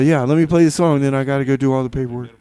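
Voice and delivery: ordinary delivery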